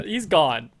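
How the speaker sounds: ordinary talk, nothing marked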